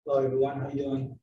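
normal talking voice

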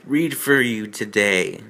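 ordinary talk

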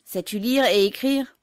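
officer shouting at the mother; shouted question. A narrator's reading. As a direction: yell it at the old woman. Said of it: "il lui cria".

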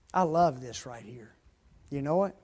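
ordinary talk

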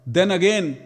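normal talking voice

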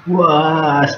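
loud, high, startled outburst; shocked. Real, not acted. Gamer startled by a sudden twist in the game. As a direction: blurt it out in disbelief.

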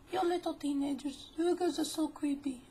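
very high, squeaky put-on old woman; mock scolding old lady. Old lady to teenagers through the blinds, mock scolding. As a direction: scold them in an exaggerated high voice.

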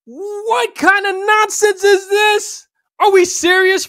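high, loud and slow, in disbelief; outraged shout. Real, not acted. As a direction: shout your outrage slowly, stretching each word. Outraged at the bodycam footage.

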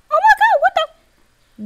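very high, panicky squeal; frightened squeak. Real, not acted. Player startled by the horror game, frightened squeak. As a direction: squeak it out in alarm.

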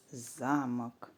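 замок said as ЗАмок; low, slow замок stressed on за; stress on first syllable. Shown as a demonstration. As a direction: put the stress at the start of замок. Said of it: "У нас вийшло".

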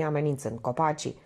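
usual speaking voice

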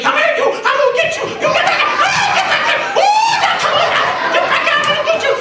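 very high, loud falsetto shrieks; frantic shrieking. Acted. Potiphar's wife chasing Joseph, frantic falsetto shrieking. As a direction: screech like a hammed-up woman in hot pursuit.

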